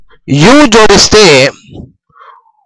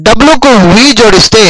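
ordinary delivery

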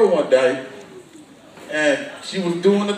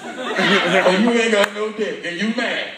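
ordinary delivery

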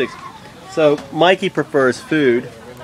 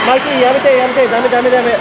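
high, rapid repeated warning; parent's raised-voice no-no; urgent scolding call; 8 semitones higher, 4 dB louder, much faster than usual. Real, not acted. Telling child to stop.

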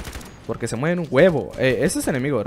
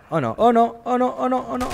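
dismayed cries; 10 semitones higher than usual. Real, not acted. Gamer losing a fight, repeated higher dismayed cries. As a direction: panicky repeated groans of defeat.

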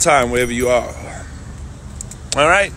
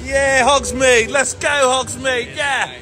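excited; 11 semitones higher, slower than usual. Real, not acted. Excited arrival at Hogsmeade.

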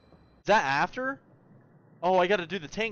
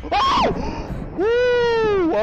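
shout; 10 dB louder than usual. Real, not acted. Startled shout.

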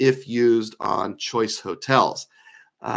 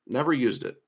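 ordinary delivery

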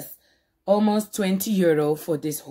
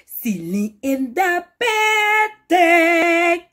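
hype intro call; 10 semitones higher, 5 dB louder, much slower than usual. Real, not acted. Vlog intro sing-song announcement.

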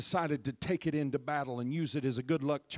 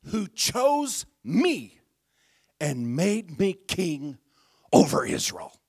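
proclaiming; 5 semitones higher, 11 dB louder, slower than usual. Retold or imitated. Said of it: "He goes on to say".

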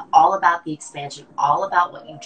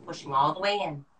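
normal talking voice

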